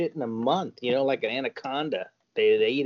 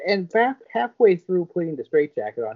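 normal talking voice

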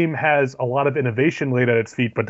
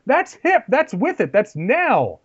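excited studio exec; 11 semitones higher, slower than usual. Retold or imitated. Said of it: "It's like".

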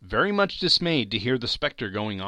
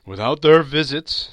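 high, loud spectral pronouncement; warning ghost; 7 semitones higher, 11 dB louder than usual. Acted. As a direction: pronounce it as a stern warning spirit. Ghost warns Scrooge.

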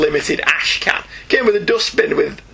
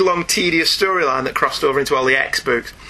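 normal talking voice

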